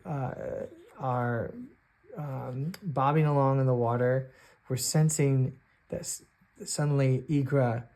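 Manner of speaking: usual speaking voice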